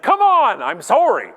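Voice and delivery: high, loud outburst dropping into apology; mock outrage that backs down at once; yell turning apologetic. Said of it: "Actually, I think I said".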